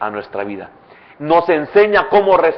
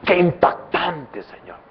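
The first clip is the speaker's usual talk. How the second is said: a touch of hushed wonder, fading to a murmur; half-whispered praise, slowing on impactante; Salvadoran awe; 4 dB quieter than usual. Pretend. Salvadoran believer praising the Lord, mild hushed awe. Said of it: "como soy salvadoreño".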